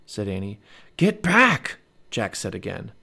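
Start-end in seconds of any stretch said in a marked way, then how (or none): 1.0-1.8 high, loud urgent command; urgent warning. A narrator's reading. Jack warns Annie off the dinosaur, urgent and loud. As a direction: order her away sharply, voice raised.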